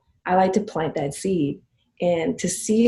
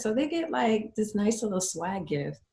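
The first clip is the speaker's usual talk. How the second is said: ordinary talk, nothing marked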